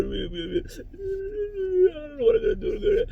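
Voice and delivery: high crying voice; imitating crying. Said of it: "she's coming out crying".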